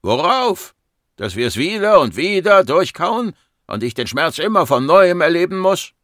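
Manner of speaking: high, loud, exasperated protest; angry outburst. Acted. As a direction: round on the doctor in pain and anger. Patient rounds on his doctor. (aufgebracht und laut)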